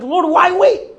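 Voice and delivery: high, loud wail of complaint; hammed-up pleading shout; mock exasperated protest. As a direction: cry out to heaven in frustration. Acted protest to God.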